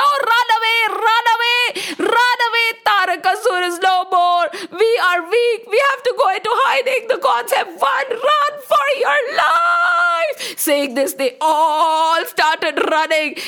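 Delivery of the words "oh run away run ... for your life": scared fleeing cries. A narrator's reading. Fleeing demons crying out in high panicked shouts. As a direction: scream to the others to flee for your lives. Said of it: "the demons got scared".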